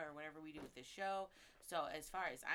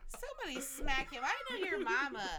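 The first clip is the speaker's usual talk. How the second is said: loud, higher teasing outburst; mock outrage; 7 semitones higher, 8 dB louder than usual. Real, not acted. Teasing a friend about his mama, loud mock outrage. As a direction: call for a smack, hamming it up.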